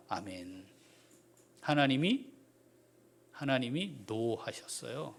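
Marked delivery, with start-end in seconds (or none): none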